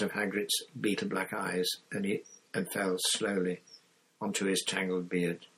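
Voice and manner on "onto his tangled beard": ordinary delivery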